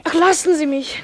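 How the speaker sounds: protest. Acted. René pushes away the seducer.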